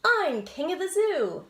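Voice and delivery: gleeful little shout of victory; triumphant cry. Acted. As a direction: crow it like a proud little king. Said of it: "he cried".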